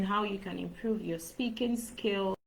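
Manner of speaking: ordinary talk, nothing marked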